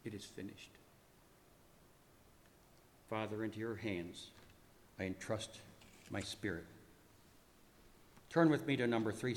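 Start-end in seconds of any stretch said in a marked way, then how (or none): 0.0-0.7 very soft, low final utterance; hushed, spent and solemn; dying words. Acted. Dying words after a long pause. 3.1-6.7 soft, low, broken by long pauses; hushed, halting surrender; dying prayer. Acted. Dying prayer, acted, in pieces.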